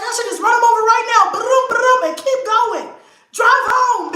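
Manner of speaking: high, loud mock-furious scheming; retold rage. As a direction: act out the wild threat at full pitch. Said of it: "So I was so fucking mad".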